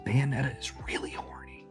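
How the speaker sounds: breathy stage whisper. Acted. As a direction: lean in and whisper the gossip. Confides a gossip line.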